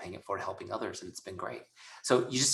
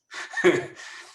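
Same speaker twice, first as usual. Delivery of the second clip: short laugh; 7 semitones higher, 5 dB louder than usual. Real, not acted. Laughing at lockdown news.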